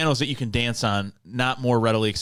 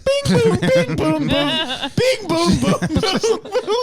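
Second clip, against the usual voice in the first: high, loud laughing "Bing, boom" piano sounds; goofy high piano-key noises through laughter; 6 dB louder, much slower than usual. Pretend. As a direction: sing out the plunking piano keys, cracking up. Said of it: "it'd just be like".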